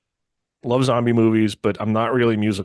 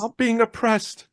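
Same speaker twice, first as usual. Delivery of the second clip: much higher, slow whiny cry of oppression; Monty Python peasant; 12 semitones higher, slower than usual. Retold or imitated. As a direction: wail it like Dennis. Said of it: "Monty Python and the Holy Grail".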